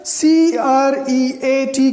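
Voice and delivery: slow letter-by-letter spelling, C R E A T; spelled out letter by letter. Shown as a demonstration. Teacher spelling a word for students, slow and deliberate. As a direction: spell out C R E A T slowly and clearly. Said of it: "یہ لفظ".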